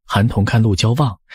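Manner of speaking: ordinary delivery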